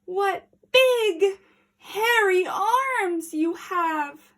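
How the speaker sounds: put-on frightened girl; careful, uneasy. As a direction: ask warily. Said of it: "Little Red said carefully".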